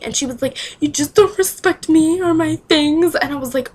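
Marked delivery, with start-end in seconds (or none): 0.8-3.1 hammed-up wail, loud, high and slow; mock bawling. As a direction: imitate an overwrought crybaby complaining. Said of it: "She was, like, bawling".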